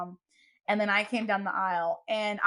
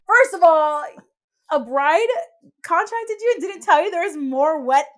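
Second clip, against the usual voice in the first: retold indignation, higher and louder; mock-outraged telling-off, pitched up with force; indignant retort; 4 semitones higher, 8 dB louder than usual. Bride retells setting a mistaken photographer straight, indignant.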